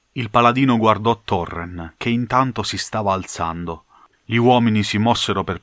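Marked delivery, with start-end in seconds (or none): none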